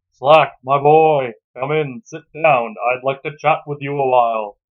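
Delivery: put-on loom over the boy as the girlfriend's father; stern father. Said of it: "I'm just gonna read it".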